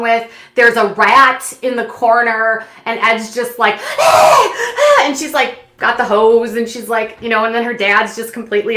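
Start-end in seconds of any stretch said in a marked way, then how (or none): 4.0-5.1 high, loud imitated yelps; mock yelp. Imitating Ed under the hose, loud high yelps. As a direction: yelp like cold water hit you.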